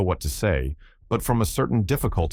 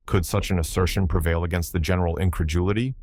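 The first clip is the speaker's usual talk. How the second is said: plain reading voice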